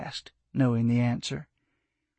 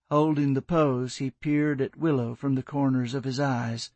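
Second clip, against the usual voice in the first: ordinary delivery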